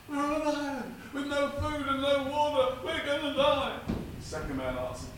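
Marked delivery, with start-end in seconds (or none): 0.1-3.8 high, louder panicked cry; panicked wail. Acted. Shipwrecked man despairing to his friend, high panicked cry.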